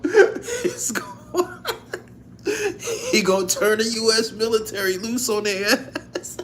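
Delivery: can barely get it out for laughing; laughing hard. Real, not acted.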